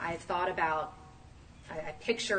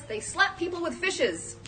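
much higher cartoon vegetable voice; Bob the Tomato; 11 semitones higher than usual. Preacher quotes a cartoon to the congregation in a higher cartoon voice. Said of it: "As Bob the Tomato says".

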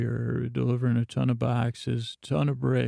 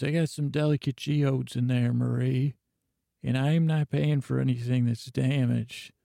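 ordinary delivery

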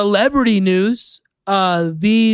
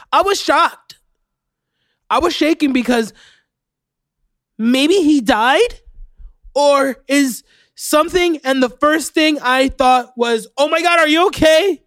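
higher, hammed-up shocked lady; dramatic witness; 6 semitones higher than usual. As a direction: overplay the neighbour's shock, voice pitched up. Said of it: "everybody's super dramatic".

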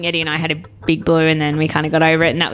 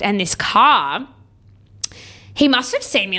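usual speaking voice

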